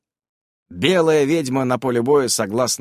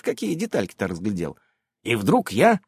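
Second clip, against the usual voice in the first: usual speaking voice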